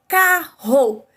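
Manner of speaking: "carro" split into two loud, separate beats; syllable by syllable. Shown as a demonstration. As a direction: break "carro" in two, car-ro, each part punched. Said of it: "duas vezes, não é isso".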